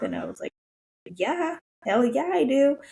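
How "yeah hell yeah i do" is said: high, loud retold burst of enthusiasm; eager yes. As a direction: shout it back like you can't wait. Retelling her eager answer to nurse.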